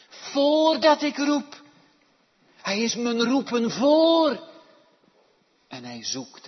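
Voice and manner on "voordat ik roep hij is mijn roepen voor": loud, much higher, slowed climax; full-force preaching. Acted. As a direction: cry it out over the congregation.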